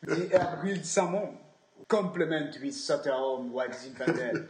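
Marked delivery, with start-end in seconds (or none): 1.9-4.5 sommelier voice. Pretend. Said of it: "A classic sommelier favorite".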